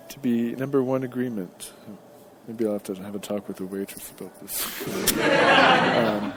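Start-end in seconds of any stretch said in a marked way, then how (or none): 4.9-5.9 short high burst of laughter. Real, not acted. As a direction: laugh it off, amused. Laughs about talking to waitress.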